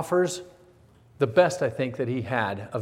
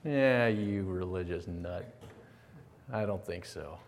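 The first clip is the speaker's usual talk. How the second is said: dismissive scoff; 6 semitones lower, 7 dB quieter than usual. Retold or imitated. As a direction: brush it off with quiet contempt. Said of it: "Paul's looking at me and saying".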